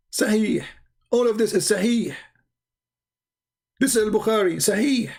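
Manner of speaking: high, scornful repeated "Sahih"; sarcastic insistence. Real, not acted. Mocking viewers with the Bukhari source, high and scornful. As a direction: hammer it home with high sarcasm.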